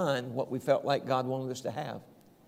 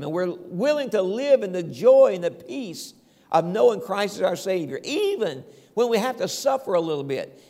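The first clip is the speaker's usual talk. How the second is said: loud, rising preacher cadence; preaching; 3 semitones higher, 5 dB louder than usual. Acted. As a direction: preach it out to the congregation with fire. Preaching the message.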